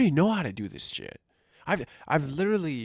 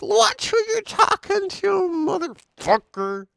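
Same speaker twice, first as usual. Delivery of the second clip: much higher, slow, loud mock snarl; mock hostile boss; 5 dB louder, slower than usual. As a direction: bark it like a boss on a power trip.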